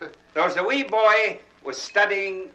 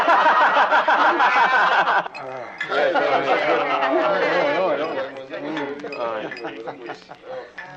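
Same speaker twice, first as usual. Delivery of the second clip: long laugh; 4 semitones lower than usual. Real, not acted. Laughs at own joke.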